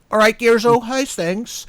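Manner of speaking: schoolboy greeting. Retold or imitated. Host imitating schoolmates hailing him by his nickname, loud matey greeting. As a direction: call out to a mate across the playground. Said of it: "those sort of schoolboy nickname".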